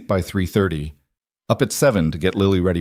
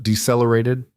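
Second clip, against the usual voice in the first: usual speaking voice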